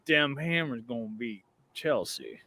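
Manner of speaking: low, soft Texas twang; Texas accent. Retold or imitated. Retelling the fan's prediction in a Texas accent. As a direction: repeat his boast in a low Texas accent. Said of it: "I don't know why the Texas accent".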